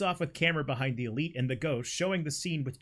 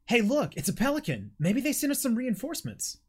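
excited hopeful; 8 semitones higher than usual. Acted. Jim hopeful at the pelican.

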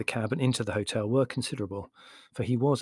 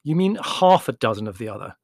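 "half" leaned on, louder; stress on HALF; 5 dB louder than usual. A narrator's reading. Larry corrects Margot's saying, stressing "half". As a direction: correct her by punching the word "half".

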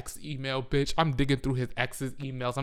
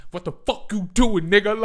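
high, loud incredulous demand; acted angry outburst; 7 semitones higher, 10 dB louder than usual. Storyteller acting out a confrontation, loud and indignant. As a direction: act out a loud, heated challenge.

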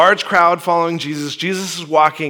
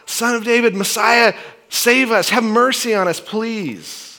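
higher, pleading call for help; crying out; 7 semitones higher than usual. Retold or imitated. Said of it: "they're crying out".

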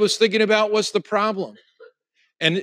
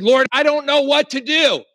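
a lifted, pleading cry to God; raised, desperate prayer at his wits' end; desperate plea; 9 semitones higher, 4 dB louder than usual. Retold or imitated. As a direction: beg with nothing left. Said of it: "I remember that I said".

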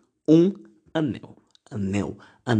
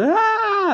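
imitated teen shriek. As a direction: imitate a crowd of shrieking teenagers. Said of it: "aqueles berros, sabe, de jovem".

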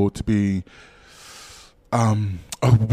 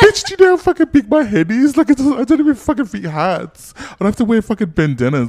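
high, loud exasperated rant; outraged rant; 11 semitones higher, 5 dB louder than usual. Retold or imitated. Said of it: "And I'm like".

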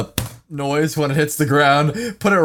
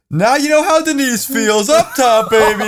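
taunting; 8 semitones higher than usual. Retold or imitated. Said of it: "and I go".

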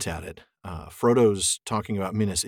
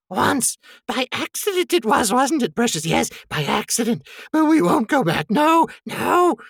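high, agitated creature voice; Gollum; 14 semitones higher, 6 dB louder than usual. A narrator's reading. Creature confessing to Frodo, high and increasingly loud. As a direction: protest high, growing louder to the end. Reads Gollum's lines in character.

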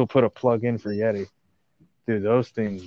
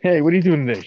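interviewer voice; 4 semitones higher, 6 dB louder, faster than usual. Acted. Acted job interview skit.

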